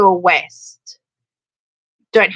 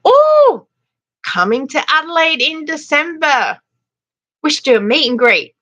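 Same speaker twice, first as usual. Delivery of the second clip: excited; 8 semitones higher than usual. Real, not acted. Excited viewer visiting Adelaide.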